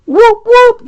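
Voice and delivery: high, loud whoop of celebration; whooping cheer. Real, not acted. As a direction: cheer it out like a hype man. Celebrating a free template.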